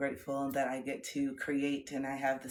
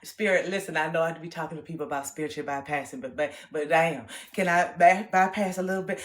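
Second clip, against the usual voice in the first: louder, mock pleading; pleading with Spirit; 7 dB louder than usual. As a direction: beg Spirit half-joking. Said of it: "I said".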